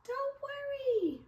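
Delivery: very high, slow little peep. Acted. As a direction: soothe the lion in a tiny voice. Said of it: "Mouse peeped".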